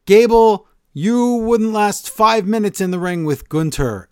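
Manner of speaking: hammed-up heel promo at Gable; wrestling promo taunt. Kaiser taunting Gable in a hammed-up promo voice. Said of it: "Kaiser says".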